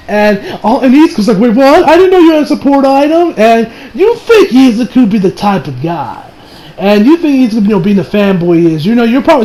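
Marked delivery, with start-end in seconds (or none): 1.4-3.3 mock startled question, higher pitched; surprised. Fan acts out Izuku learning of a support item, surprised. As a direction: act out a caught-off-guard fanboy.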